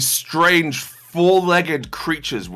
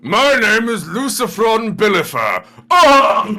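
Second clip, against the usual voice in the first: much higher, slow, hammed-up official ending in a shout; absurd pushed voice; 9 semitones higher, 6 dB louder, slower than usual. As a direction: stretch the name out, then yelp. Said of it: "let's see how far we can push it".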